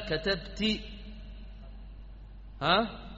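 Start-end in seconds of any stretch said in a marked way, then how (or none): 0.1-0.8 "كتبت" said katabti, ending in ee; final -ti. Shown as a demonstration. Teacher lists verb endings for class, "كتبت" as katabti. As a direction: close "كتبت" on a clear -ti. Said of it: "وممكن تقول".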